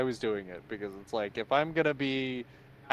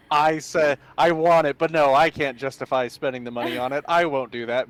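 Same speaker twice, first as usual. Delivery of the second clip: mock protest; 3 semitones higher, 8 dB louder than usual. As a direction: argue with yourself about the price. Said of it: "I was like".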